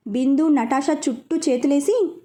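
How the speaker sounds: plain reading voice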